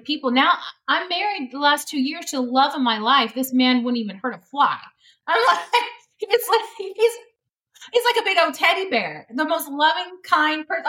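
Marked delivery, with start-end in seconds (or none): 5.3-10.9 giggly gushing. Real, not acted. Gushing about her husband.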